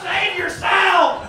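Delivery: high, loud, slow hammed-up yell; playful alarmed yell; mock shout. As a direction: shout a warning at the joggers. Throwing hot dogs at joggers.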